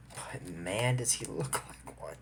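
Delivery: low, very soft aside; hushed aside. Real, not acted. Reviewer confiding that the teacher looks villainous, hushed aside. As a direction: drop almost to a whisper.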